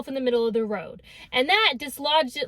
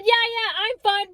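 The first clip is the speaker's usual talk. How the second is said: higher, breezy brush-off; 8 semitones higher than usual. Retold or imitated. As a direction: wave it off like nothing's wrong. Brushing off while choking.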